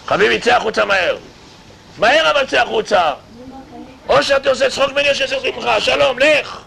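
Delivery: high, loud and rushed mock order; angry demand. House committee yelling at the tenant, loud and fast. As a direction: bark it like an outraged house committee.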